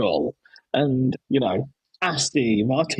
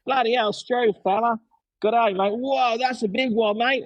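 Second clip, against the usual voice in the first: high, chirpy Australian tradie voice, pitched up; playful Australian bloke impression, pitched up; Australian accent impression; 8 semitones higher than usual. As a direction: do the Aussie drain guy, cheerful and cheeky. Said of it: "just going".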